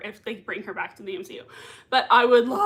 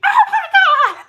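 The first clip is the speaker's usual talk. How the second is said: high, loud, drawn-out fangirl squeal; mock fangirl scream; 14 dB louder, slower than usual. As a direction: scream it like the hero just appeared. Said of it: "I'm gonna be like".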